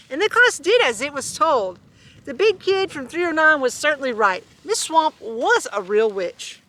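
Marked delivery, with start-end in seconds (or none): none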